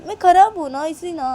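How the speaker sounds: acted indignant self-blame; hurt outburst. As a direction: throw it back, offended. Acted quarrel, hurt wife.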